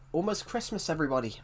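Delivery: usual speaking voice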